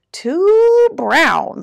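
loud, high rooster squawk; storybook rooster, high and loud. A narrator's reading. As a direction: squawk it, stretching the first word. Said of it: "squawked Rooster".

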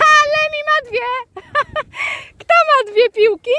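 delighted, very high squeal with laughter; real excited dog talk. Real, not acted. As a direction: gush at the dog in a high, giddy voice. Zachwyt nad psem z dwiema piłkami. (piskliwy zachwyt nad psem)

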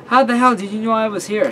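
high, loud, incredulous question; startled protest. Acted. Hider found by friend in the tree, startled protest.

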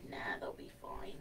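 hushed aside, barely voiced; whispering. Real, not acted. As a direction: whisper it as a quick joke. Joking aside about visitors.

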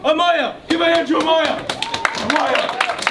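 rally the room for her; hype cheer. Real, not acted. Cheering on a volunteer.

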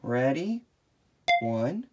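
plain reading voice

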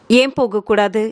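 ordinary delivery

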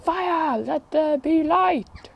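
high, slow mock-epic declaration; grand proclamation. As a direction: proclaim it like a god. Proclaiming over the bonfire.

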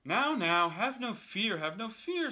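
loud, high, breezy showman; cheerful cat. Acted. Cat reassures the fish, loud and high. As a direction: soothe the fish like a grand showman. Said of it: "said the cat".

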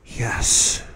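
barely audible, breathed yes; sighed whisper. A narrator's reading. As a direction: let it out as a sigh. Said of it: "no more than an audible sigh".

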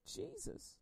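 breathy whisper of the name; a reverent whisper. Acted. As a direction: hush it like a secret. Name whispered in sermon poem.

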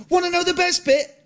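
plain reading voice

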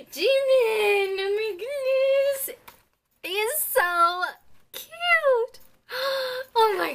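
gasping shrieks of delight; ecstatic squeal. Real, not acted. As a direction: scream with fan joy. Gets favorite member, screams.

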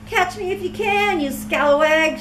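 high, cheeky teasing; taunting. Acted. Clara taunts the crow, high and cheeky. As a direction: tease the crow. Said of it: "she taunted".

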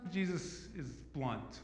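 ordinary talk, nothing marked

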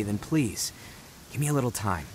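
quiet, pleading request; soft plea. Acted. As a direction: ask gently, almost begging. Pleading to be trusted.

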